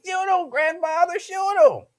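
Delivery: put-on high, childish urging; mock toddler voice urging grandfather; little baby voice. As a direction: squeak it like a little kid egging on. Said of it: "Little baby like".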